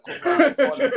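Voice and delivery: loud, high challenge shout. Retold or imitated. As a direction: yell it out to start a fight. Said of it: "I was like".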